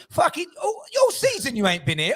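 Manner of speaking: high, loud playful yell at the rival fan; mock outraged shout. Real, not acted. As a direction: bellow the jab in fake outrage. Ribbing a rival fan caller.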